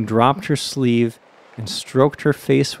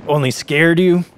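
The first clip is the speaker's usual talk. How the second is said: slightly raised, dismissive question; understated scornful asking; unsympathetic query; 4 semitones higher than usual. A narrator's reading. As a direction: question him without sympathy. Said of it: "queried the peddler unsympathetically".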